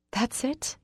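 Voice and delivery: high, incredulous bitterness; bitter. A narrator's reading. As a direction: scoff at him in disbelief. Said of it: "I laugh bitterly".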